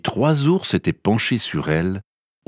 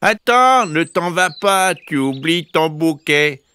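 high middle-bear call; 9 semitones higher, 3 dB louder than usual. A narrator's reading. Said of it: "de sa moyenne voix". (appel aigu du moyen ours)

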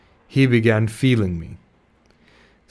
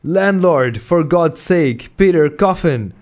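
high, loud panicked yell for the landlord; desperate cry for the landlord; shouted for help; 6 semitones higher, 4 dB louder, slower than usual. A narrator's reading. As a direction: scream for rescue in terror. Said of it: "shouted I".

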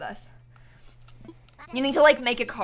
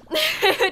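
high burst of laughter; 4 dB louder than usual. Real, not acted. Laughs after doubting herself.

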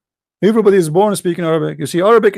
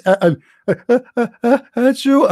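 halting uh uh then a high fake achoo; mock sneeze build-up; 4 semitones higher than usual. Acting out Adam about to sneeze, a hammed-up build-up.

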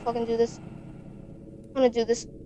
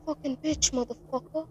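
startled hushed cursing; 14 dB quieter than usual. Real, not acted. Jump scare in horror game.

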